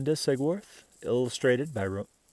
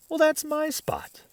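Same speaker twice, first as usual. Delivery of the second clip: put-on high, huffy little girl; indignant sister. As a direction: stake your claim like a kid. Sisters squabbling at sleepover.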